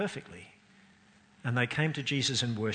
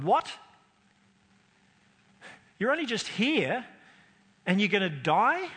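incredulous; 7 semitones higher, 5 dB louder than usual. Retold or imitated. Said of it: "everyone who'd been waiting would have gone".

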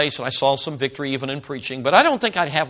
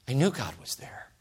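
awed whisper; 10 dB quieter than usual. Real, not acted. Preacher telling the church in an awed whisper. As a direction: breathe it in a hushed whisper. Awe at God's presence.

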